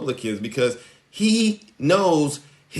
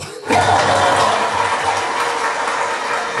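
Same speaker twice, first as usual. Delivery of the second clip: breathy, unvoiced burst of laughter; a long real laugh; 5 dB louder than usual. Real, not acted. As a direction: laugh out loud at your own joke. Laughs at own joke.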